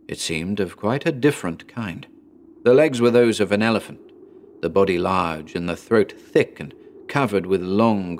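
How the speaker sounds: ordinary delivery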